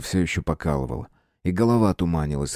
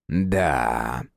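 drawn out; 7 semitones lower than usual. Acted. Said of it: "протянул Фергюс".

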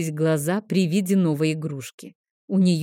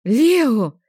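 higher, louder shocked yelp of the name; shriek; 6 semitones higher, 7 dB louder than usual. Acted. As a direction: squeal at the son in horror. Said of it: "взвизгнула я". (визгливый окрик)

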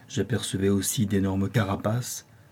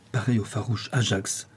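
usual speaking voice

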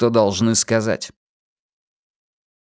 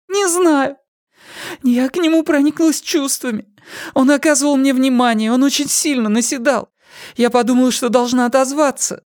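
put-on higher, hesitant young woman; 14 semitones higher, 3 dB louder than usual. A narrator's reading. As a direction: confess timidly as a young woman. Pip voiced by narrator.

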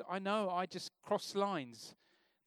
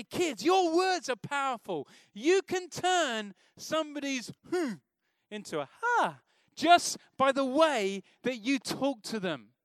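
put-on talking to kids; 8 semitones higher, 9 dB louder, slower than usual. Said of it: "and I say".

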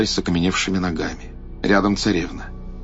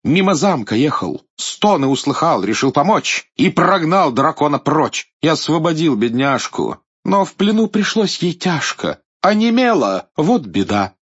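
loud, high boastful knight; proud hero tells of his deed; heroic knight; 7 semitones higher, 4 dB louder than usual. Acted. Белый рыцарь хвастливо рассказывает. (хвастливый рыцарь)

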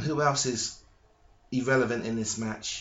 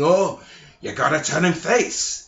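raised, gruff boss voice, a touch louder; Vince McMahon impression; 4 semitones higher, 5 dB louder than usual. Podcaster doing Vince McMahon deciding to turn Miz face, gruff impression. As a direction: growl it like the chairman scolding. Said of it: "That's my Vince Kennedy McMahon impression".

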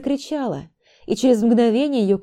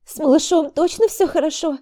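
high, worried asking; anxious question; 9 semitones higher, 3 dB louder than usual. A narrator's reading. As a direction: fear for the baby. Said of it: "Обеспокоенно посмотрела она".

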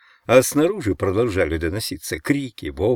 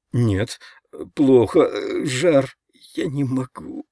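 higher, slow, faltering refusal; plaintive fevered protest; feverish whimper; 5 semitones higher, slower than usual. A narrator's reading. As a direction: moan it weakly, in broken bits.

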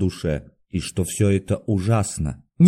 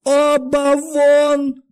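very high, stretched-out yell; continued shouting; 4 dB louder, much slower than usual. A narrator's reading. Doctor still chasing two guests out, shouting. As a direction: hold each word in a frenzied high shout. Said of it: "продолжал кричать Андрей Ефимович".

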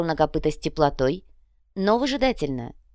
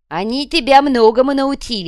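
one-eyed leader; 7 semitones higher, 7 dB louder than usual. A narrator's reading. One-eyed leader comments to Копыта.

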